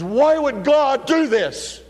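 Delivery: high, loud, slow frightened wail; terrified and shaking. Retold or imitated. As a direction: ask it trembling in fear, slowly drawn out. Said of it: "She's just shaking".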